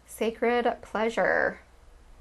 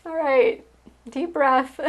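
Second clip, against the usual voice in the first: laughing emotionally; 5 semitones higher than usual. Real, not acted. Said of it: "starting to get emotional".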